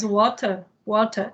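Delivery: water with a crisp British T; British "water", T fully sounded, no R; British accent. Shown as a demonstration. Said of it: "é mais britânico".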